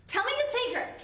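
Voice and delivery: put-on high, loud girly Glinda voice; a pushy friend begging to hear a secret; mock Glinda. Roommate presses Elphaba for her secret, high and girly. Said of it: "Glinda's like".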